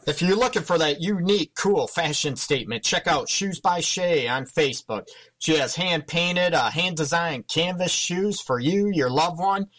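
radio ad read. Acted. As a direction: sell the shoes to listeners. Reads a sponsor ad.

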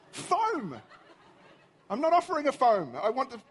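high-pitched, exasperated protest; indignant disbelief. Retold or imitated. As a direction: object incredulously to being misheard. Outraged at Siri's mishearing.

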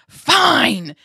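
high, very loud exasperated shout; loud outburst. Retold or imitated. Woman fighting with the Lord finally gives in, exasperated shout. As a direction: burst it out, giving in angrily. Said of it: "I said, literally out loud".

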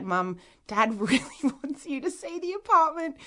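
high, laughing mock plea; retold coaxing. Daughter coaxes mum to visit dad's apartment, laughing plea. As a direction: act out wheedling a parent, half laughing.

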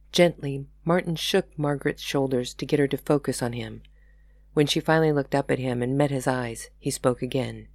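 usual speaking voice

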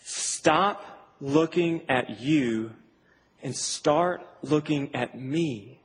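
very slow, measured and deliberate; God addressing Moses. Retold or imitated. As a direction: speak as the Lord, slowly with weighty pauses. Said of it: "the Lord looks at Moses and says".

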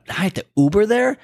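high, rising disbelieving question; incredulous. Retold or imitated. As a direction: climb in pitch as if you can't believe it. Incredulous at having to Uber.

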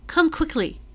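loud, high call across the river. A narrator's reading. Page boy calls Richard from the riverbank, loud high shout. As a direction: shout his name to fetch him. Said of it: "I call out".

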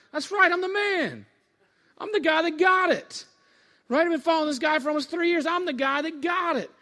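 high, loud swaggering brag; cocky boasting. Acted. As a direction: crow proudly like the star pupil. Peter boasts after being praised.

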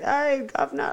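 high-pitched mock British street accent; British accent impression. Shown as a demonstration. Asking a friend which British accent, mock British accent. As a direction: put on a broad British working-class greeting. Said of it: "was it, like, the Coronation Street accent".

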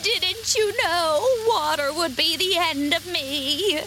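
high, slow witch wail; wailing, despairing. A narrator's reading. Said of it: "in a wailing, despairing voice".

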